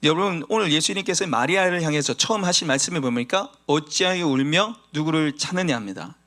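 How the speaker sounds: ordinary talk, nothing marked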